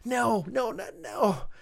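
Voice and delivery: high, desperate screaming no; screams of disbelief. Acted. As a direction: scream it in horror. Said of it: "Dave let out screams of disbelief".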